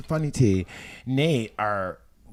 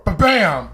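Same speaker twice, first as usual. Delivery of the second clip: door slam; 5 semitones higher, 8 dB louder than usual. Retold or imitated. Door slamming sound imitated.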